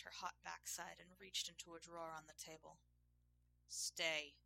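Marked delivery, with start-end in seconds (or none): 3.7-4.4 loud one-word order; commanding. A narrator's reading. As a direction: give a firm, loud command. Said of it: "he commanded".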